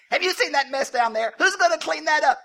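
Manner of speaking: high-pitched comic shouted complaint; hammed-up loud griping; imitated indignant Noah. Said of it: "and Noah said".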